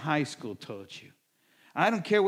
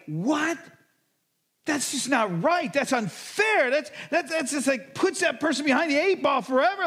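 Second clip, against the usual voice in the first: high, loud indignant outburst; indignant reader's outburst; outraged; 11 semitones higher, 5 dB louder than usual. Retold or imitated. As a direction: protest the injustice, fired up. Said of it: "fired up".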